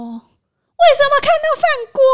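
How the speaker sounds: high, loud indignant mock demand; shrill it out like a client who feels cheated; imitating angry aunt. The aunt angrily demands why the rice cooker shows, mock outrage. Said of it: "他就生气".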